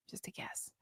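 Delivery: hushed conspiratorial whisper; whispered aside. Real, not acted. To her viewers, sharing a guess in a whisper. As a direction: drop to a secret whisper.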